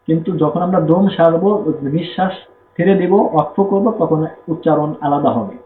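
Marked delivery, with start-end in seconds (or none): none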